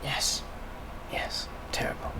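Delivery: very soft whispered agreement; hushed whisper. Acted. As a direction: barely breathe the words. Old woman confiding, hushed.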